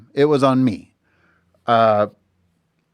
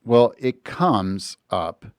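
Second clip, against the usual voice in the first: usual speaking voice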